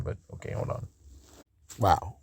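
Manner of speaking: whispered hold on under the breath; breathy aside to himself; muttered aside. Real, not acted. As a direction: drop to a near whisper. Muttered aside while pausing video.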